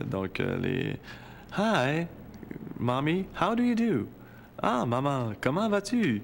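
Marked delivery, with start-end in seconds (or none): 1.6-4.1 mocking anglophone girl. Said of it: "les accents toniques de l'anglais". 4.6-6.2 much higher, exaggerated anglophone lilt on "comment vas-tu"; English-stressed French. [name] mocks Angel's French to her mom, high anglophone lilt. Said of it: "les accents toniques de l'anglais".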